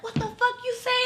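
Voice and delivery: high-pitched angry challenge, acted out; snapping. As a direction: re-enact snapping at a boyfriend. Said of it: "the first time I snapped".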